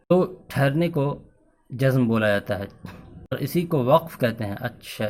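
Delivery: ordinary talk, nothing marked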